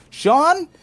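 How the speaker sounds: high, loud rising question; shocked recognition. Retold or imitated. Pranked worker spotting who is in the car, shocked recognition. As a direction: call the name out in high-pitched disbelief.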